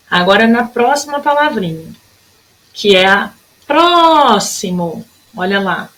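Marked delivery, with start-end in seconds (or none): 3.7-5.0 "próximo" with a long held "pró"; slow "próximo", lingering on "pró"; stretched first syllable. Shown as a demonstration. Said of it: "que durou mais tempo".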